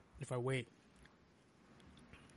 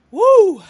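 very loud, high "woo"; piercing real yelp from the heat; pained whoop; 22 dB louder than usual. Real, not acted. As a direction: whoop hard against the burn. Blowing off the spicy burn.

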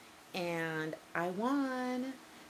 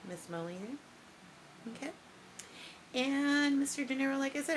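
normal talking voice

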